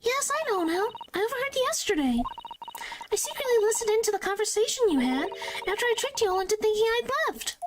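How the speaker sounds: put-on high, girlish confession; high-pitched child character, quick at the end; Iris voice. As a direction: voice a clever young girl, pitched far up. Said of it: "you didn't think Iris would listen?".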